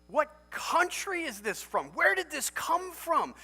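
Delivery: high, slow, marvelling questions; amazed wonder. Acted. Believer marvelling at God's love, slow and amazed. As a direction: gasp it out like you can't believe it.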